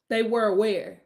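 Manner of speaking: ordinary talk, nothing marked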